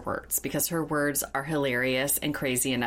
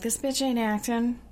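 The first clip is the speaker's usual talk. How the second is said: sassy retort; 7 semitones higher than usual. Retold or imitated. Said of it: "I'm like".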